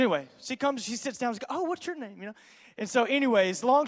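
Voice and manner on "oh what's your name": high-pitched, feigned casual greeting; mock-innocent high voice; imitated coy woman. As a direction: lift the voice high, mimicking her.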